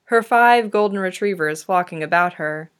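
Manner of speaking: usual speaking voice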